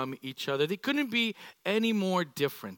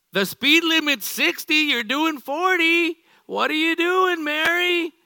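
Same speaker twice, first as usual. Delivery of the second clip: much higher and louder, fed-up tailgater; exasperated driver; 10 semitones higher, 8 dB louder, slower than usual. Retold or imitated. Type A driver stuck behind Mary, loud exasperated yelling. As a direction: yell at the slow car ahead. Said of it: "Type A's driving behind Mary going".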